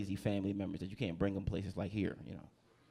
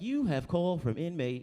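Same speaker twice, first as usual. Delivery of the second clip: higher, measured automated phone operator; automated prison call voice; 5 semitones higher than usual. Acted. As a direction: announce it like a recorded jail call. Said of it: "they call you on the phone. It's like".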